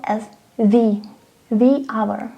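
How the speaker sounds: THE said "thee" before hour. Shown as a demonstration. Teacher showing a silent-H word, THE as "thee". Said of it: "we are pronouncing once again article".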